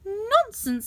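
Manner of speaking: high wife voice; waving it off, pitched high; giant's wife. A narrator's reading. Wife dismissing the giant, high voice. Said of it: "said his wife".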